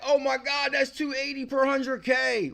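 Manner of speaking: hammed-up amazed outburst; imagined shock. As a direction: squeal it like you just saw the price. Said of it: "So I would have been like".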